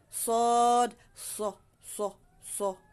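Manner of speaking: "Sod" long and loud, then short heavy "So"; thick SOD. Shown as a demonstration. Teacher models the heavy letter, thick SO. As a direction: stretch "Sod", then clip three rounded "So". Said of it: "Makapal siya".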